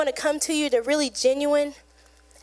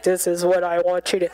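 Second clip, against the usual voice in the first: loud God voice; 7 semitones lower than usual. Retold or imitated. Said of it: "He doesn't talk in a loud".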